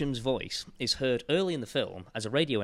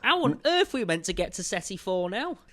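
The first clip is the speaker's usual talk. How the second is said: put-on Northern accent; 10 semitones higher, 4 dB louder than usual. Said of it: "'Cause they're all Northern".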